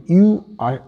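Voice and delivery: ordinary talk, nothing marked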